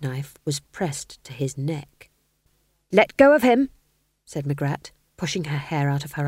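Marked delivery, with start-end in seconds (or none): 2.9-3.7 higher, louder sharp order; fierce command. Acted. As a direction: bark it with a knife at his throat. Magrat threatens with a knife.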